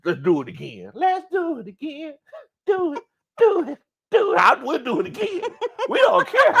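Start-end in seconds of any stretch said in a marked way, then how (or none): 0.9-4.4 squealed urging. Real, not acted. Urging everyone to repeat the parade, high excited squeal. 4.4-6.5 high laughing outburst; gleeful defiance. Real, not acted. Telling doubters fans don't care, high and laughing.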